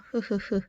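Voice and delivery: 흐흐흐 as short separate puffs of H; repeated 흐흐흐 consonant demo; breathed H sound. Shown as a demonstration. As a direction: puff out "흐흐흐" like the start of house. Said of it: "house를 하게 되면".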